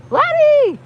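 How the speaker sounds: high, loud, thrilled greeting; excited squeal. Real, not acted. Streamer squealing hello to a viewer. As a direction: squeal the name with joy.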